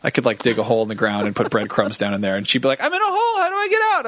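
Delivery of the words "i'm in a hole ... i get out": very high, silly bewildered voice; dopey voice. Pretend. Joking Jen is stuck in a breadcrumb hole, silly high voice. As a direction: play her as hopelessly lost.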